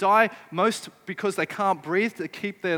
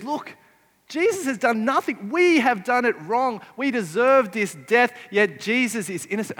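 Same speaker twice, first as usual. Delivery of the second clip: higher, louder urgent admission; earnest confession; 5 semitones higher, 5 dB louder than usual. Retold or imitated. Repentant criminal rebuking the other, urgent and earnest. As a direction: plead it with rising urgency.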